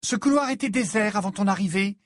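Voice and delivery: high-pitched indignant retort; protesting. A narrator's reading. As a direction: protest at being interrupted. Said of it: "protesta Ginny".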